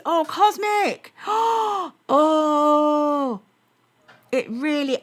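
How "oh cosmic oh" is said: high, loud, drawn-out delight; excited gasp. Real, not acted. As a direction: gasp with joy for the viewer. Viewer going to Toronto.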